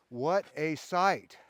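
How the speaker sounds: slow and loud, savoring each word. Real, not acted. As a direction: draw it out in awe. Savoring view, repeated plainly after.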